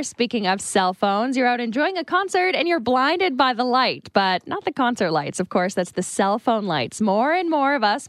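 steady radio news delivery; news read. Acted. Reads a news story.

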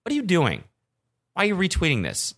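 high incredulous what are you doing; exasperated disbelief. Retold or imitated. To a friend sharing parody accounts, high exasperated disbelief. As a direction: scold a friend for retweeting fakes. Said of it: "and you're like".

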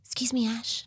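small whispered voice of a son; hushed, careful little voice; whispered boy asking for his mum; timid whisper. Retold or imitated. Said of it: "he'll be like".

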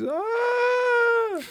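put-on piercing, drawn-out toddler wail; high mock crying howl; imitated child crying. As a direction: bawl like a little boy in pain. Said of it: "And he was crying".

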